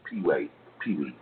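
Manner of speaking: ordinary delivery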